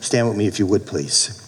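normal talking voice

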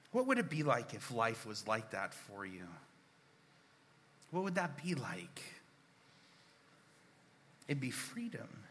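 ordinary delivery

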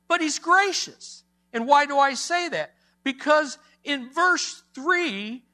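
high, loud, emphatic sermon delivery; full-force preaching. Real, not acted. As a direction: proclaim it to the congregation with rising force.

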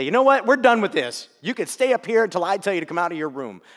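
mock scolding a child; angry parent. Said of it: "spank this kid and say".

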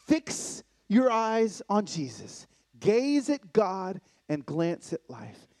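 slow, loud, weighted preaching; preaching emphasis. Acted. Preacher urging the church to fix eyes on Jesus. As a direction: hammer each word home.

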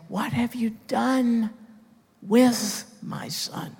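a lightly voiced God, high and slow on "with my Son"; voicing God. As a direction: ask it as the Father would, slowly. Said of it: "and he's going to say".

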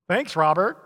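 normal talking voice

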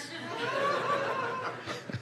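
a squeaky, breathy burst of laughter; tiny shrieking laugh at his own joke; high squealing laugh. Real, not acted. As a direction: let a high giggle escape. Laugh after the Moabitess joke.

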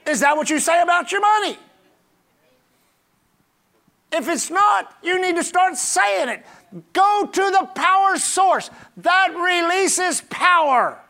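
high, ringing, hard-stressed exhortation; full-force preaching. Real, not acted. Preacher challenging the congregation about money, full-force preaching. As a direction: drive it out to the back of the church.

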